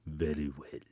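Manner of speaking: low, soft growl. Acted. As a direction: growl it like a leopard. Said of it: "growled the leopard".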